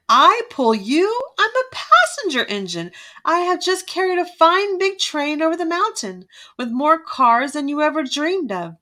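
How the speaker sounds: high, indignant boast of a haughty engine; snorting disdain. A narrator's reading. Shiny passenger engine snubs the broken-down train, snorting with disdain. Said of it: "the shiny new engine snorted".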